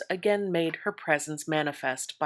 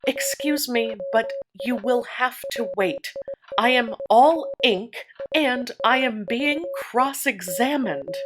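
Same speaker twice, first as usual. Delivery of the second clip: exasperated; 6 semitones higher, 3 dB louder than usual. A narrator's reading. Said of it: "with the courage of despair".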